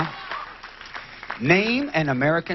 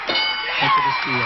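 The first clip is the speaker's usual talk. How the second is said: drawn-out excited call; slower than usual. Real, not acted. Host calls out to team.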